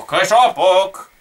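KECZOPOK stretched out long and loud; drawn-out exclamation. Real, not acted. Gamer announcing Keczopok flying out, drawn-out exclamation. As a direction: draw out Keczopok, loud and slow. Drawn-out take, then said usually.

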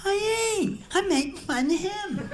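very high impeded voice; speech impediment voice. Retold or imitated. Marty replies to the customer in a very high impeded voice. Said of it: "a little speech impediment".

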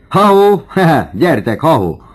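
high, loud hallooing; calling out. Acted. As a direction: holler to summon the others. Calling the others over mid-horseplay. (hangos, magas hívogató kiáltás)

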